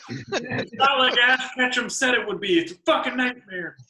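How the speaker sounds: high, loud laughing through the words. Real, not acted. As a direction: laugh out the complaint. Laughing at the visor joke.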